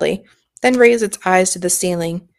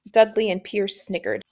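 ordinary delivery

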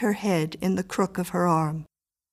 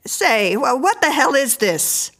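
loud, raised, indignant demand; startled man; 6 semitones higher, 5 dB louder than usual. A narrator's reading. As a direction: challenge someone who has barged in. Man startled by woman barging in.

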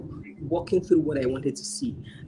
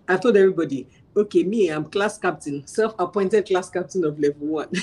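ordinary talk

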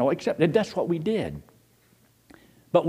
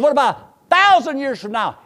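high, loud mock challenge; loud quoted question; 11 semitones higher, 9 dB louder than usual. A man challenging the preacher, high and loud.

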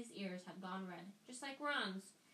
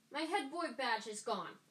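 angry; 4 semitones higher, 6 dB louder than usual. A narrator's reading. Percy rounds on Harry.

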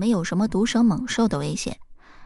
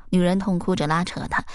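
ordinary delivery

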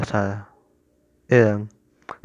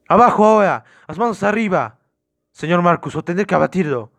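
high, loud opening, easing into warning; armed police command; 6 semitones higher, 4 dB louder than usual. Acted. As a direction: shout orders at gunpoint. Officer at gunpoint orders him down.

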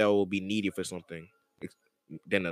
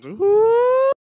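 high loud ooh; 9 dB louder than usual. Retold or imitated. Imitating the fan's noise.

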